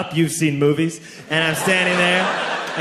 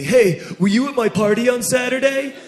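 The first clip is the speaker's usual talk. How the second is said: higher-pitched imitated kid; classmate asking; 6 semitones higher than usual. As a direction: put on a higher voice, questioning upward. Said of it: "And he says to me".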